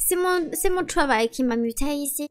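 put-on French spoken with a mild American accent; [name]'s lightly put-on American accent in French. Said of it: "avec votre accent ridicule".

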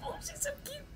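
cooing. Real, not acted. Cooing at Zelda on screen.

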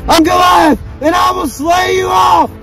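giant's battle shout. Acted. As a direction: bellow a slow threat at the whole army. Giant threatens his enemies in battle.

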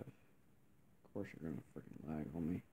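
low whispered complaint at the lag; bitter mutter. Real, not acted. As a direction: mutter it under your breath. Muttering as game lags.

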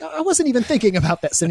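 high put-on voice of denial; mock innocent sinner. Host voicing a tempted person's denial, high and innocent. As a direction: play it falsely innocent and high. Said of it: "It's like".

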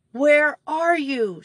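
high, slow frightened cry; shrieking. A narrator's reading. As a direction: shriek into the dark. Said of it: "shrieked Betsy".

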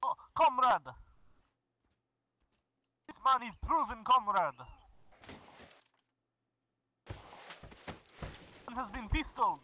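hammed-up Russian accent, broken English; mock Russian accent, gamer joking; fake Russian accent. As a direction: play a comic Russian comrade in a Russian accent. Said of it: "tired of doing the voice".

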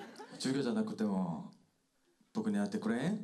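slow Nagoya dialect line, loud and rising at the end. Shown as a demonstration. Actor delivers the teacher's line in Nagoya dialect for the fans. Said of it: "ヨン先生、お願いします".

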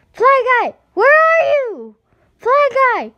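very high, loud calling across the dump; crying out. Acted. Boy calling his fly in the dump, loud high call. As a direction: call far out for a lost pet. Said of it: "he cried".

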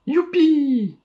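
very high delighted whoop; joyful cheer. Acted. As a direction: cheer with joy.

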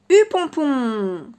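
slow, stretched giddy-up call; drawn-out call. A narrator's reading. Narrator announcing the chapter title to the children, slow and stretched.

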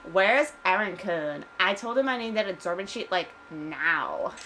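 put-on demanding Valley Girl with drawled vowels. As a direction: play an impatient Valley Girl. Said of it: "like Valley Girl".

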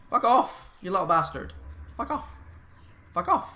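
loud, high furious swearing; angry swearing. Real, not acted. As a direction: snap at the zombies. Swearing at zombies in game.

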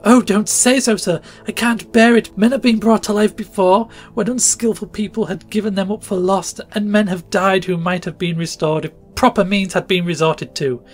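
high, loud desperate begging; frantic pleading. A narrator's reading. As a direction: plead with him to save her son. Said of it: "with a burst of passion amounting almost to frenzy".

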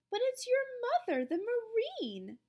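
high start, dropping as it goes; insistent teacher. A narrator's reading. Teacher insists on the phone call.

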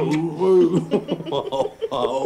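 light flirty laugh; giggling at the boss's joke; playful laugh. Acted. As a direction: laugh off a tease from the boss. Flirting in the office.

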